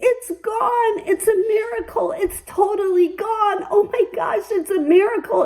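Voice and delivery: high, elated doctor announcing the cure; overjoyed oncologist breaking miraculous news; excited doctor. Retold or imitated. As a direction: burst out with thrilled disbelief as the doctor.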